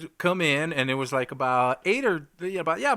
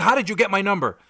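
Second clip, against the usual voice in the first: retold angry caller; 6 semitones higher, 7 dB louder, faster than usual. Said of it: "he's freaking out".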